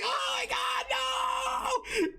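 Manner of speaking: high, slow wailing shriek; a hammed-up panicked cry; over-the-top horrified howl; mock freakout. Obito freaking out over scythe.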